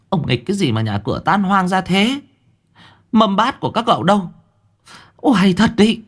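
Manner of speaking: high, rising exasperated scolding. Acted. Wife scolding husband over the wrecked yard, high-pitched. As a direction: tell him off for the wreck.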